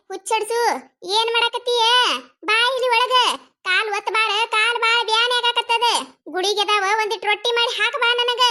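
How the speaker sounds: high, complaining mother-in-law voice; demanding mother-in-law. Acted. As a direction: call the daughter-in-law in with aches and demands.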